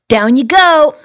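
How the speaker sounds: loud, high fighting-game announcer; Soul Calibur voice. Shown as a demonstration. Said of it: "Soul Calibur voice".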